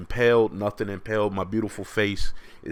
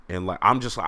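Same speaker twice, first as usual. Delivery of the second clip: ordinary talk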